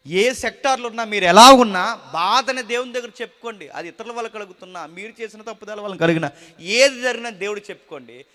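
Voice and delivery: loud, high, fervent preaching; full-force preaching. Acted. Preacher thundering to the congregation about fear, loud and fervent. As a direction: drive the point home to the congregation.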